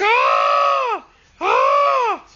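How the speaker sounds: screaming. Retold or imitated. Boy screaming while the ghost bites. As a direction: keep screaming in terror. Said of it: "And I was screaming".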